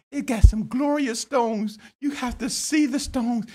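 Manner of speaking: high, excited boasting about the stones; bragging disciples. Acted. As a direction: show off the glorious stones. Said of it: "this is what they said to Jesus".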